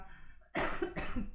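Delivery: sudden choking cough; coughing fit. Real, not acted. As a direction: cough from a swallowed drink. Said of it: "j'ai avalé de travers".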